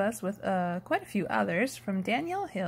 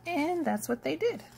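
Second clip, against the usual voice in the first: plain reading voice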